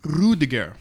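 Rudiger with the R rolled German style. Shown as a demonstration. Showing his German R, he rolls Rudiger. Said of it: "I roll my R's in German".

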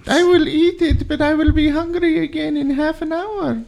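put-on high little-kid voice; resigned child. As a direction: glumly give in as a small child. Child reluctantly accepts plain rice.

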